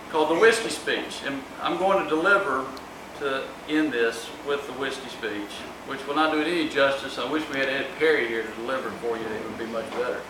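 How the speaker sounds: ordinary talk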